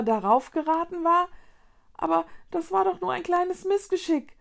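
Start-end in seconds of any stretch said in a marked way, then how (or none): 1.9-4.4 high-pitched, bewildered protest; confused. A narrator's reading. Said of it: "sagte sie verwirrt".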